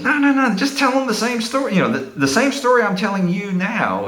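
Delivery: imitating a friend's high, eager insistence; mock insistence. Friend urging him to tell tenants the story, insistent.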